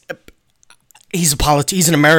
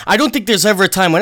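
ordinary delivery

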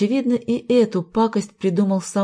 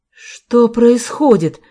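loud, slow, raised-pitch demand; angry; 5 semitones higher, 7 dB louder, much slower than usual. A narrator's reading. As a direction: demand an answer indignantly. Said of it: "разозлилась она". (сердито, громко, протяжно)

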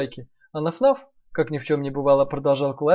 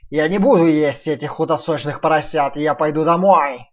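loud sly wolf; 7 dB louder than usual. Acted. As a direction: announce it loud to be heard. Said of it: "сказал он так громко".

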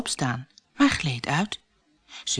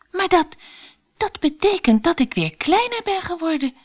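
high Alice voice, halting over "dat, dat"; stammering; 12 semitones higher than usual. A narrator's reading. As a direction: stumble on the words in surprise. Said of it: "stamelde ze". (hoog stamelende Alice)

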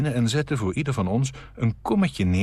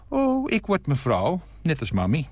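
don't-mind-me tone; 7 semitones higher than usual. A narrator's reading. Small boy answers the guest about his future, don't-mind-me tone. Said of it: "op een let maar niet op mij toon".

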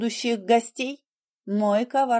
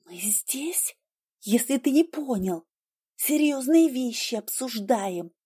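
hissed whisper; 3 semitones higher, 3 dB quieter than usual. Acted. Continued hissed reply.

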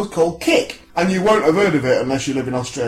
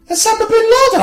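high, sharply raised exclamation of recognition; excited shout; 4 dB louder than usual. Acted. Raji recognises his caller on the phone, excited shout. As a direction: cry the name out high. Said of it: "I shouted".

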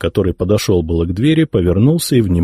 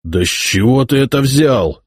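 indignant; 6 semitones higher than usual. A narrator's reading. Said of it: "возмутился Яромир".

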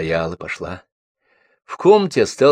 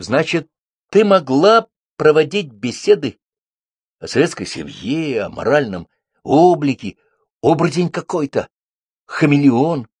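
raised pitch, rising indignation; indignant accusation; 7 semitones higher than usual. A narrator's reading. Bibichev rebukes Lyolya over her hypocrisy, indignant.

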